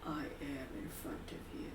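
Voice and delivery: low, hushed, dark rasp; dark, raspy voice. Acted. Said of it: "a dark, raspy voice".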